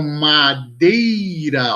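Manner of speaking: "madeira" slowly, ei held as one syllable; diphthong demonstration. Shown as a demonstration. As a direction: draw out "madeira" as ma-dei-ra. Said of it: "na mesma sílaba". ("madeira" devagar, com o ditongo ei junto)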